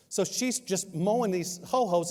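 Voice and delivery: usual speaking voice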